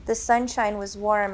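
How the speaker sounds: plain reading voice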